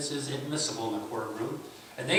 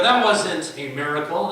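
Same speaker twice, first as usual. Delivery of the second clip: dismissive skeptic; 5 semitones higher, 10 dB louder than usual. Retold or imitated. Skeptic voiced dismissing the trick.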